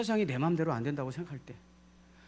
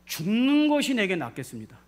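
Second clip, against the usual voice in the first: imitated indignant outburst, raised and louder; loud, higher-pitched retort, acted as fed up; angry outburst; 4 semitones higher, 6 dB louder than usual. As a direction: act out Jonah's flare of anger. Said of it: "확 화가 나가지고".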